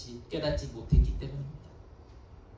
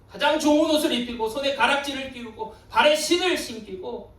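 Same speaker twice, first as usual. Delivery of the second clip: high, loud surges that drop away at sentence ends; impassioned preaching; 11 semitones higher, 6 dB louder than usual. A narrator's reading. Preacher telling how the father ran to his lost son, impassioned. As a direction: preach it with swelling force.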